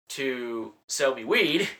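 ordinary delivery

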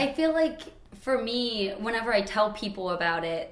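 ordinary talk, nothing marked

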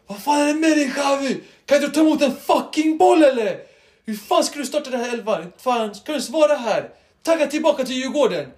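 loud, high, furious coach rant; imitated coach yelling. Player imitates coach screaming at his squad, furious yelling. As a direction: yell abuse like an angry coach. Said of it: "han skriker liksom så här".

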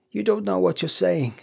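high, troubled protest; unsteady. A narrator's reading. Husband dismissing his wife's wild idea, unsteady. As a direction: protest anxiously with lifted pitch. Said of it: "he said unsteadily".

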